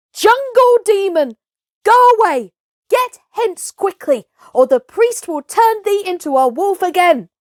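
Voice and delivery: high, loud furious yells; angry crowd shouting. Acted. Villagers shouting at Mowgli to drive him off. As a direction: hurl it at him like a mob. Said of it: "the villagers shouted".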